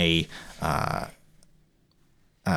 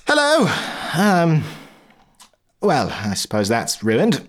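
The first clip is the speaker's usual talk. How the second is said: flustered Polly; 9 semitones higher than usual. Acted. Polly after machine explodes.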